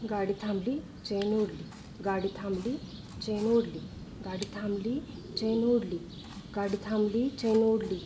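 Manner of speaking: low, hushed recitation, same tune each time; rote memorising. Pretend. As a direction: recite softly as if learning it by heart. Memorising dictated testimony by repetition. (हळू, खालच्या आवाजात घोकणे)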